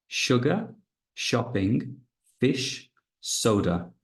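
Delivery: sugar, shopping, fish with a clear sh, soda with s; careful word list. Shown as a demonstration. Teacher reading words for students. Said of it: "Listen and circle what is different".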